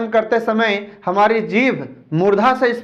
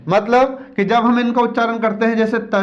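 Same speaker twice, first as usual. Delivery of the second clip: ordinary talk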